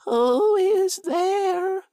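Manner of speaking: high, slow quavery granny; frail old woman calling; old grandmother voice. A narrator's reading. Grandmother calls from bed.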